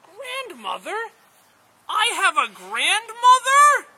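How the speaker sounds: very high, slow, drawn-out astonishment; amazed. Acted. First man learns he has a grandmother, high-pitched amazement. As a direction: squeal it slowly, building to a loud cry.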